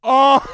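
high, explosive burst of laughter; a loud cry of oh breaking into helpless laughing; loud belly laugh. Real, not acted. Hearing the worst song title, real uproarious laugh.

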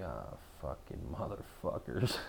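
soft, low grumbled curse; annoyed mutter. Retold or imitated. Fed up with the cheering group, muttered low. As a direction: grumble it under your breath.

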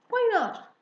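high, loud, abrupt "Why not?"; indignant challenge. Acted. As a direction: demand a reason, loudly and high. Father challenges the girls' request.

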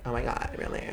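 creaky vocal fry; soft, creaky LA girl fry. Shown as a demonstration. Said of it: "I wanna talk like that".

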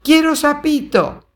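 put-on high, loud childish whine; whining little girl. A narrator's reading. Little girl demanding the toad, high loud whine. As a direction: beg like a stubborn toddler. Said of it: "La voz de la niña".

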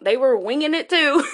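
amused laugh at parents winging it. Real, not acted. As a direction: chuckle it out as you admit it.

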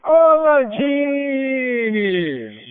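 long, stretched call ringing with echo; echo effect. Real, not acted. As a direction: draw it out slowly with heavy echo. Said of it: "Tá com, tá com eco agora".

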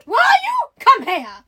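loud, high outburst dropping into a command; hammed-up exclamation. A narrator's reading. Indian gentleman calls the startled girl over, loud exclamation. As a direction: shout it as an excited gentleman. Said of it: "he exclaimed".

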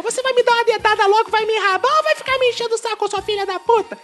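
very high squeaky cat cursing; high cartoon pet swearing at mom; foul-mouthed cat voice. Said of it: "Eu fico assim". (voz de gato fininha xingando)

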